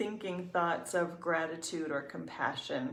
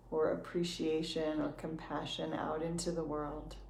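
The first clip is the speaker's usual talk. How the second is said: soft, unhurried meditation voice with long pauses; calm, even guidance, leaving room to breathe; guided meditation; 7 dB quieter than usual. Real, not acted.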